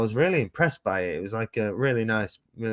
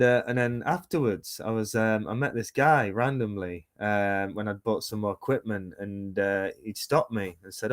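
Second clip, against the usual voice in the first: ordinary talk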